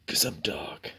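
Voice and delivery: whispered, playfully dark; mock edgy whisper. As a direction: breathe it like a brooding joke. Joking about liking dark mode.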